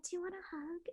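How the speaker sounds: high, soft storybook fairy greeting; sweet fairy voice. Retold or imitated. Fairy cosplayer to little girl, high soft sweet greeting. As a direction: coo at the child like a gentle fairy. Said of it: "I'm like".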